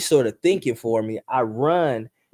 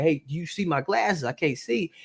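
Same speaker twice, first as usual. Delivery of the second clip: frantic; 5 semitones higher, 5 dB quieter than usual. Retold or imitated. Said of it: "and I'm frantic".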